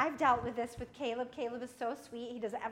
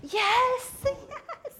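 joyful cheer; 4 semitones higher, much slower than usual. Pretend. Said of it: "You may be saying".

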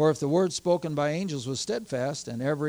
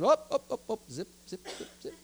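hushing him; 6 semitones higher than usual. Retold or imitated. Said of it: "I said".

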